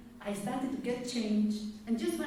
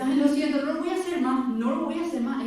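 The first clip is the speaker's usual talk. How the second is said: put-on louder and higher, pleading like a small child; little child apologizing; 5 semitones higher, 9 dB louder, faster than usual. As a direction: whine out the apology like a kid caught. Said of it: "¿Y qué es lo primero que dice?".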